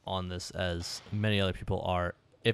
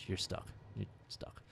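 whispered; 3 semitones lower, 15 dB quieter than usual. Real, not acted. Trailing off into whisper.

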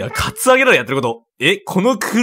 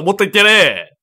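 normal talking voice

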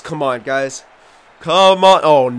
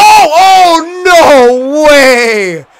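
much higher, loud, thrilled cry; excited shout; 10 semitones higher, 9 dB louder, much slower than usual. Real, not acted. Gamer seeing a great pass, excited shout. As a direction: burst out in amazement.

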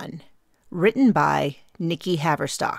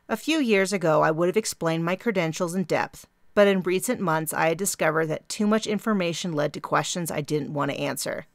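ordinary delivery

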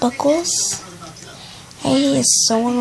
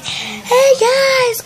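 much higher, louder mock cheery call; mocking the friend; 12 semitones higher, 5 dB louder than usual. Girl mimicking her friend announcing to everyone, high mock cheer. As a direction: parody the friend's chirpy hello. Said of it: "She's been saying".

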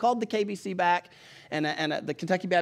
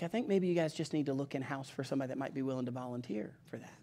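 soft, low-key, careful brush-off; gone quiet; 4 semitones lower, 11 dB quieter than usual. Retold or imitated. Lady ending the phone call with a suggestion, quiet. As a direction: imitate someone quietly letting the caller down. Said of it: "she got real quiet".

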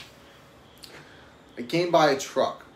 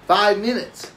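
incredulous exclaim; 7 semitones higher, 6 dB louder than usual. Real, not acted. Outraged at being yelled at.

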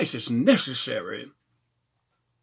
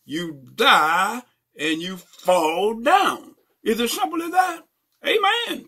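raised, emphatic pulpit delivery; full-force preaching; 7 semitones higher, 5 dB louder than usual. Acted. Preacher urging listeners to breathe and live, full-force preaching.

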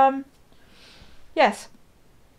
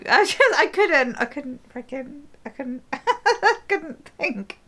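laughing through words; 7 semitones higher than usual. Real, not acted. Still laughing after scene.

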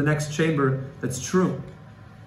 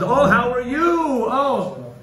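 higher, slow, louder gushing hello; fawning greeting; 8 semitones higher, 4 dB louder, much slower than usual. Acted. Father gushing over a rich guy, higher and louder. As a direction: fawn over the rich visitor. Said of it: "rich guy comes".

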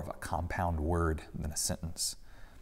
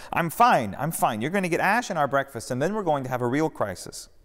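dismissive; 7 semitones higher, 4 dB louder than usual. A narrator's reading. Jesus brushing off concern.

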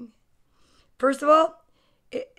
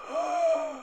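sharp gasp; 6 dB quieter than usual. Real, not acted. Excited reaction to mustache page.

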